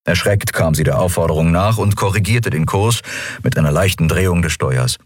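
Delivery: usual speaking voice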